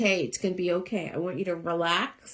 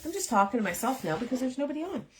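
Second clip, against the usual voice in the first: normal talking voice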